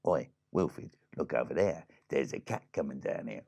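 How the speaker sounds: low, soft crab voice; low-voiced crab on the lookout; land crab Reggie. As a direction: warn a pal in a deep, quiet voice. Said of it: "they were talking to each other".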